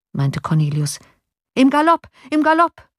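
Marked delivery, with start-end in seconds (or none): 1.5-2.9 high, loud urgent call. A narrator's reading. Johann calling to the coachman to gallop. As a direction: drive the horses on with your voice. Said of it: "rief Johann".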